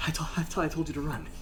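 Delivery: quick, weak chiding from a fallen knight; lightly voiced dying warrior; dying mentor. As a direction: scold gently while fading. Dying master chides his student.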